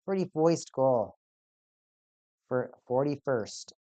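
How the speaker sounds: "Forty-first" said as "forty-foist" in a slight accent, slowly. Shown as a demonstration. Fan catching himself saying the stat in an accent, slow "foist". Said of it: "I just keep on saying it in an accent".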